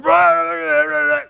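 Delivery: loud high raging growl at traffic; 模仿路怒的大声吼叫; mock road rage. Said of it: "you find yourself going".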